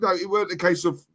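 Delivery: normal talking voice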